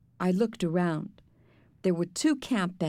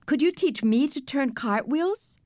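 eager high-pitched request; calling out; 6 semitones higher than usual. A narrator's reading. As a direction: call out an eager girl's question. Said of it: "Sheila called".

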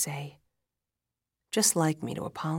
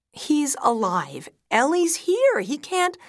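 loud, high, frantic insistence; desperate denial; 10 semitones higher, 10 dB louder than usual. A narrator's reading. Wife refusing to believe husband died, frantic denial. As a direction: insist against the news, pitch soaring.

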